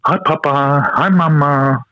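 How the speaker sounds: put-on high, cheery toddler hello. Dad imitating his daughter barging in at four am, high and cheery. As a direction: chirp it like a wide-awake two-year-old. Toddler greeting parents at 4am.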